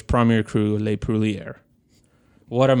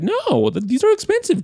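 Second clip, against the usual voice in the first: much higher, incredulous protest; shocked protest. Retold or imitated. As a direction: squeak out the objection. Protesting to his boss.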